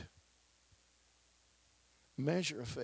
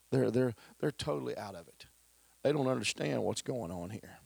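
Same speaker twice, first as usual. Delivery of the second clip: ordinary talk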